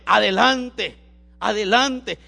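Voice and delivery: urgent exhortation. Retold or imitated. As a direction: call it out to urge someone onward. Quotes God urging him onward. (exhortación enérgica en voz alta)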